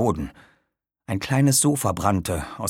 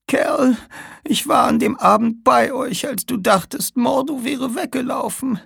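put-on high, strained, urgent old woman; dying old woman; slower than usual. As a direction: warn them with her last breath. (sterbende alte Frau, drängend)

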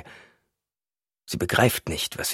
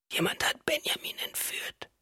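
weak whisper; 10 dB quieter than usual. A narrator's reading. Weak wife insists on abduction.

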